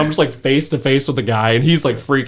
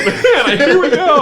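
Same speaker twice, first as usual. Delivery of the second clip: laughing, much higher cartoon plumber voice; Mario Kart Mario; 7 dB louder than usual. Throwing beers at the cop like Mario Kart, cartoon voice. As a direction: toss the cans like Mario throwing bananas.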